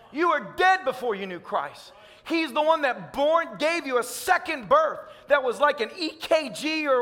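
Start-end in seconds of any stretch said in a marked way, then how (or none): none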